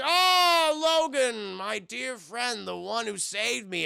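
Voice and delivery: put-on drawn-out, high cry of an old man's welcome; old man greeting. Old man welcomes the friend who saved him, effusively. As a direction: greet an old friend in long, swooping words.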